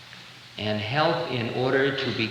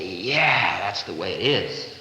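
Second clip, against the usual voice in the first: low, drifting stoned hippie agreeing; stoned agreement; 7 semitones lower, slower than usual. Retold or imitated. Hippie at fireplace agreeing with a profound remark, low and dreamy. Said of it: "And you say".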